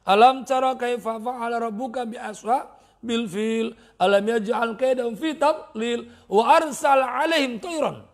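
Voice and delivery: slow measured Quranic chant; Quran recitation. Acted. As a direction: recite the verses slowly, chanting.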